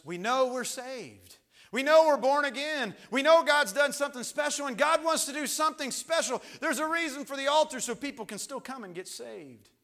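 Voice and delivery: loud, high-pitched pulpit delivery; urgent preacher at full volume; full-force preaching. Real, not acted.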